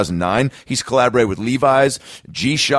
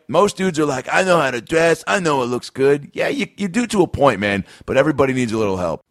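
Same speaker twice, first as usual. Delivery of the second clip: hyped ad read; 6 semitones higher than usual. Acted. Host pitching sponsor shades to listeners, hyped ad read. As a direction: sell it like a radio spot.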